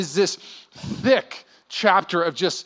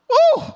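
ecstatic whoop; 6 dB louder than usual. Pretend. Kid given Toys R Us.

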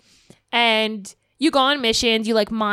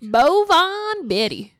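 character voice; 6 semitones higher, much slower than usual. Shown as a demonstration. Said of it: "No, I don't like that either".

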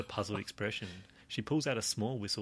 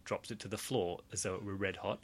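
normal talking voice

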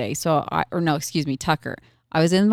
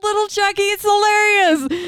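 cracking up; 13 semitones higher than usual. Real, not acted. Mom finds her son's nickname hilarious, cracking up. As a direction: squeeze words out through laughter. Retelling her uncontrollable laughter.

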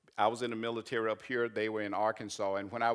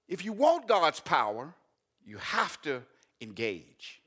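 rising, louder, higher preaching that slows at the peak; preaching at full force; 8 semitones higher, 6 dB louder than usual. Acted. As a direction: build the sermon to a slow shouted peak. Preaching climax.

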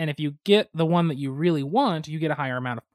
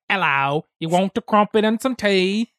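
louder, higher trashy British; trashy British accent; 4 semitones higher, 5 dB louder than usual. Shown as a demonstration. Showing friends a failed accent, trashy British. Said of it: "when I try to do British".

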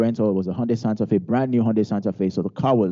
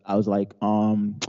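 ordinary delivery

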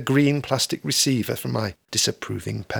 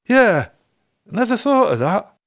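sudden realization; 9 semitones higher, 5 dB louder than usual. Acted. Rob realizing.

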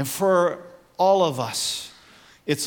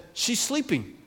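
calm quiet reply; 7 dB quieter than usual. Retold or imitated. Jesus quietly answering the scoffing crowd. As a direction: answer the mockers softly and calmly. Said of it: "Jesus said".

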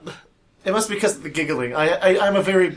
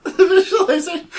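laughing; 13 semitones higher, 7 dB louder, slower than usual. Real, not acted. Laughing at a gross image.

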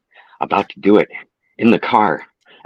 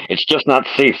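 stern; 7 semitones higher than usual. Acted. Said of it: "said Doc said sternly".

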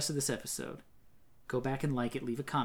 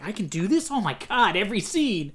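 mock excited director; 5 semitones higher, 6 dB louder than usual. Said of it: "It was just like".

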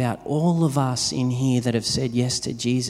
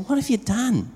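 surprised question; 10 semitones higher than usual. Retold or imitated. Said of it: "and I said".

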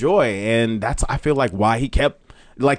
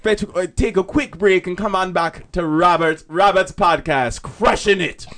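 higher-pitched playful Jamaican announcer; mock Jamaican radio plug, pitched up; Jamaican accent; 5 semitones higher than usual. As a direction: throw to the break in a put-on Jamaican accent.

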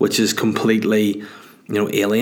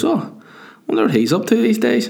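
higher, lightly put-on curious thought voice; inner musing voice; 9 semitones higher than usual. Voicing his own wandering mind mid-work, lightly higher curious musing. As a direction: voice the idle thought in your head, a touch playful. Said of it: "sometimes your mind goes".

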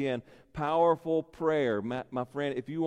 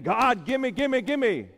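mock demanding; 9 semitones higher, 3 dB louder, slower than usual. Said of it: "We don't come to God demanding".

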